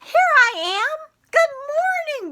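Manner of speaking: high, loud puppet voice; puppet greeting. Acted. Puppet Miss Moo greets the boys and girls, high and loud. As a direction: pop up and greet the class squeakily.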